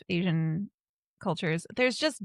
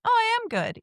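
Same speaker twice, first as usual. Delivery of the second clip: high, louder perky realization; self-convincing cheer; 14 semitones higher, 5 dB louder than usual. Acted. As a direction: talk yourself into feeling good. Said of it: "meant to trick your brain".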